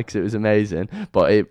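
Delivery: ordinary talk, nothing marked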